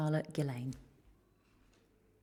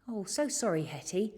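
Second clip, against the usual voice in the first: usual speaking voice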